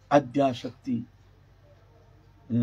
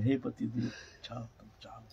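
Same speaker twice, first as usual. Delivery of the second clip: very soft, lower, retold farewell; suppressed grief; 4 semitones lower, 12 dB quieter than usual. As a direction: let it fade to almost nothing, holding sorrow in. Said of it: "बड़े दुख के साथ अपने दुख को संवरण करके".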